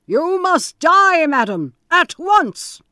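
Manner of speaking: very high, loud and slow; cruel death sentence. Acted. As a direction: pronounce her doom with cold cruelty. Bluebeard sentences his wife.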